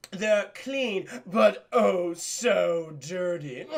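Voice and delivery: slow, drawn-out creepy villain relish. Retold or imitated. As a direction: linger lewdly on each word. Acting out Hopper's creepy mud-bath line.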